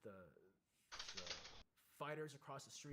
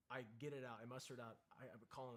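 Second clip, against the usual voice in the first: ordinary delivery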